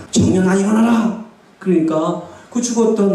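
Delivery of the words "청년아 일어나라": Jesus's command. Acted. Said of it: "예수님이 딱 멈추게 했잖아".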